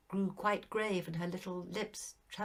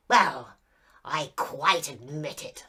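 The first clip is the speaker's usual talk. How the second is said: petulant; 3 semitones lower, 11 dB louder, slower than usual. A narrator's reading. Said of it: "said the ghost petulantly".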